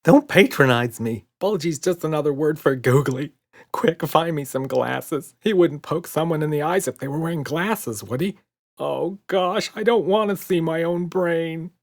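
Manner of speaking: fussing in a nervous hurry; fretful monster. A narrator's reading.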